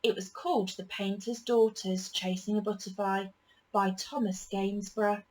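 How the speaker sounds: ordinary delivery, nothing marked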